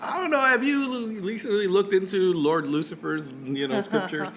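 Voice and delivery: a playful hint of an innocent-sounding proselytizer; lightly mocked Satanist dater.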